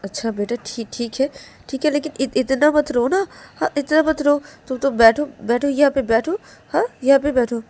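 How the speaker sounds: concerned elder consoling. Acted. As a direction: coax a crying child to sit. Man consoles, seats Ananya. (चिंतित बुज़ुर्ग, ऊँचे सुर में मनाते हुए)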